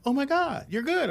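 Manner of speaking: high mock-amazed gush; gushing producers. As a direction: play the stunned, gushing exec.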